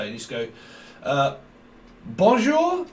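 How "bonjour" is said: squeaky hesitant "Bonjour?"; high unsure "Bonjour?" like a nervous schoolboy; high mock French bonjour.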